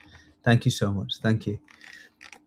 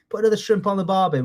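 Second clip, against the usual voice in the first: put-on Australian accent; 6 semitones higher, faster than usual. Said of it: "the Australians say things like".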